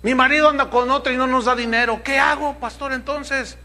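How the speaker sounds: hammed-up anxious mother; desperate widow. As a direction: beg the pastor for an answer. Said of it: "dirá otra".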